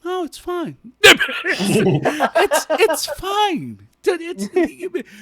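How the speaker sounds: high, laughing "no, it's fine". Retold or imitated. Worker laughs off the supervisor's offer, high and giggly. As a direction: brush it off through laughter. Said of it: "I'm like".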